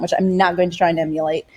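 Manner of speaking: normal talking voice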